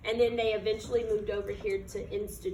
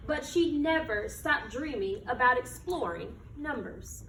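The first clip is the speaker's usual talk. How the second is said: put-on reading to children; 4 semitones higher, 3 dB louder than usual. Teacher reading a picture book to her class, storytelling voice. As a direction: read it like storytime on the rug.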